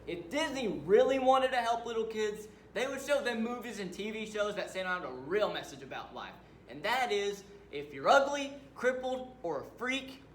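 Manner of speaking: high, loud, mounting tirade; angry rant. Acted. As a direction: rail against the company. Killer rants against Disney.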